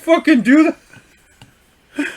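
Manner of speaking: high, loud, drawn-out imitation; incredulous outburst. Friend laughing at driver wanting a refund, incredulous.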